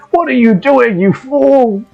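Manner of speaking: high, exasperated scolding; scolding sheep. Acted. Sheep scolding the adventurer for pulling a mandrake, high and exasperated. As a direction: scold them like a fussy know-it-all.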